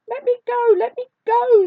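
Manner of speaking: very high, loud mouse squeal; piercingly high, alarmed little cry; frightened squeak. A narrator's reading. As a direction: squeak it out in panic as a tiny mouse. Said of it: "squeaked the mouse in fright".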